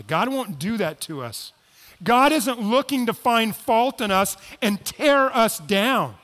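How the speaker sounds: usual speaking voice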